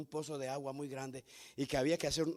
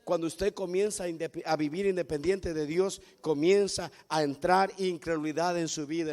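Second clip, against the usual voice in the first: ordinary talk, nothing marked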